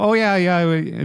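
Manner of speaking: slow, high, drawn-out Minnesotan "Oh, yeah, yeah"; Minnesota accent. Retold or imitated. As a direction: stretch it out like a Fargo local. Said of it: "That's like a Fargo".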